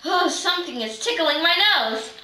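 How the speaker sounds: usual speaking voice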